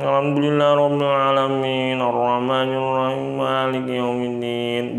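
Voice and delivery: grudging, sulky recitation of the verses; sulky mumbled recitation. Shown as a demonstration. Teacher demonstrating reading Quran sulkily, mumbled and grudging. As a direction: recite like a sulking child who doesn't want to. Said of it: "kayak orang lagi ngambek".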